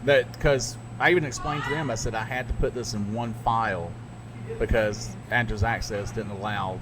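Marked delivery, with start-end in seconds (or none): none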